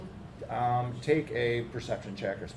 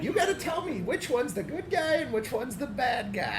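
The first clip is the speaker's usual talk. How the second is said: put-on old lady voice; 13 semitones higher than usual. Old lady keeps bargaining.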